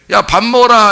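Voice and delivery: loud call to come eat. Retold or imitated. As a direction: shout the dinner call. Mother calling child to eat.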